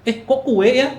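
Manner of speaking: high, loud thoughtless question; blurted out. Acted. Hungry guest seeing only cake, blurting it out. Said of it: "kita bisa nyeplos dong kayak gitu".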